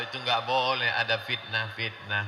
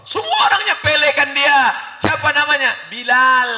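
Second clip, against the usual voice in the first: high, very loud exclamation; full-force pulpit shout; preaching shout; 13 semitones higher, 11 dB louder than usual. Real, not acted. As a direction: cry it out to the whole congregation. Preacher bursts out about Bilal.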